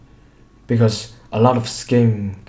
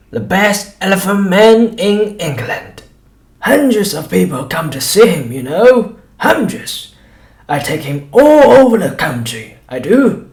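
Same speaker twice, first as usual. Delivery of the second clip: high, loud fairground barker; greasy shopkeeper bragging to the doctor; boastful showman; 9 semitones higher, 7 dB louder than usual. A narrator's reading. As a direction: sell it like a cheap showman.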